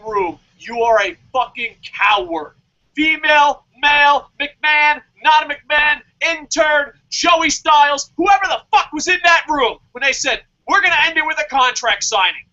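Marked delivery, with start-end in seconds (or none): 2.9-12.5 higher, louder furious list; outraged roll call of cowards; angry shout. Real, not acted. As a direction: shout down every name in the room. Angry rant listing everyone.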